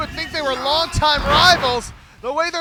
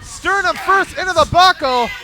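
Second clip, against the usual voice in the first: excited play-by-play; wrestling commentator; 7 semitones higher, 5 dB louder than usual. Real, not acted. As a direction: call the action live.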